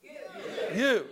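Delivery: jab "you" as a mock accusation; ticked off. Shown as a demonstration. Preacher coaching the congregation to blame like Moses, ticked off. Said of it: "you're, you're ticked off".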